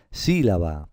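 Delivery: "Sílaba" stressed at the start, SÍ-la-ba; correct antepenultimate stress. Shown as a demonstration. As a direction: put the force on SÍ and let "la-ba" fall. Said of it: "Pues sí, es esta". ("Sílaba" bien acentuada en la antepenúltima: SÍ-la-ba)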